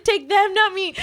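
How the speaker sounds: high, loud joking plea; mock desperate plea. At the gate, a high mock plea to God. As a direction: bargain with God, half laughing. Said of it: "I was like".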